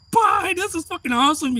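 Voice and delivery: very high, loud thrilled holler; elated spectator yelling; excited shout. Retold or imitated. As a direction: yell a gleeful send-off. Reliving own thrilled farewell.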